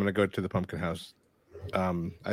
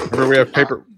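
raised, emphatic reminder; loud correction; 6 semitones higher, 12 dB louder, faster than usual. Real, not acted. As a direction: call it out over the table. Player correcting loudly.

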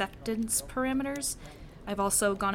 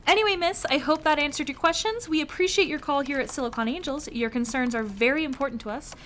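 phone menu voice; 2 semitones higher than usual. Acted. Switches to customer service script.

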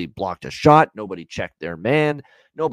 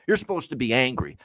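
usual speaking voice

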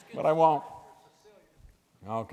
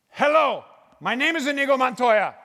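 movie quote; 9 semitones higher, 13 dB louder than usual. Pretend. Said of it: "I heard some people over here using an accent".

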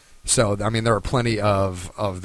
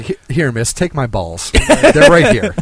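creepy guy voice; 6 semitones higher, 8 dB louder than usual. Acted. Mocking a manspreader offering seat.

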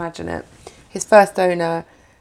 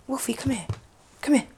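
soft cooing; 4 semitones higher, 7 dB quieter than usual. Retold or imitated. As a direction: coo gently at the dog, almost whispering. Mum cooing at dog again.

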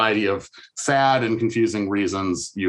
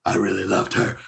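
imitating Miss Jane. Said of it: "who talked like this".

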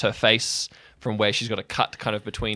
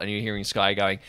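usual speaking voice

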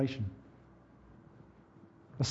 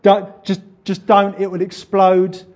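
louder, higher, urgent pleading; warning inner voice; 4 semitones higher, 8 dB louder, slower than usual. Acted. Inner voice warning a dad off arguing, urgent. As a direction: urgently hold yourself back. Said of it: "there's a voice in me going".

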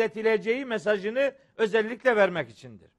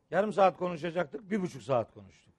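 usual speaking voice

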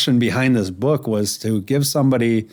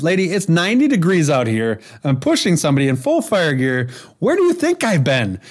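exasperated mock yell, rising high at the end; imagined yell back; 5 semitones higher than usual. Fireman to the lady in the street, imagined exasperated yelling. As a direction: build to an indignant high-pitched retort. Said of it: "I could have yelled at her".

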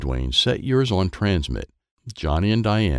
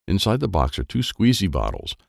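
plain reading voice

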